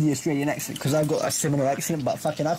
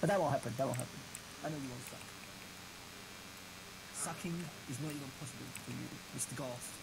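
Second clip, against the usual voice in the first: very quiet, lowered voice; soft mock reassurance; 5 semitones lower, 18 dB quieter than usual. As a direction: drop right down to a hushed, reassuring murmur. Mock-reassures the guitarist after threat.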